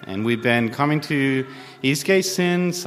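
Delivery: normal talking voice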